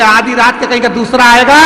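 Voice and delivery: high, loud exasperated snap; who else would it be; indignant retort. Acted. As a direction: beaten husband snapping at his wife. Husband retorts after being beaten.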